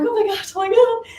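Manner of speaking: high-pitched giddy outburst; excited. Retold or imitated. Nail tech showing a client her new passport, excited.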